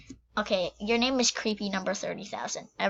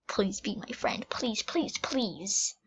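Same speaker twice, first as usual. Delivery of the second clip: hushed pleading whisper; soft whispered plea; whispered begging; 7 dB quieter, slower than usual. Pretend. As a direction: beg softly for a friend. Begging game character for friendship.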